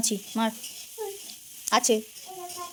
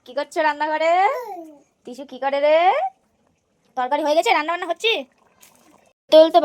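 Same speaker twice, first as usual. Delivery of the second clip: loud, higher call into the kitchen; 6 semitones higher, 10 dB louder than usual. Real, not acted. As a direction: call playfully to someone in another room. Calling to people in the kitchen.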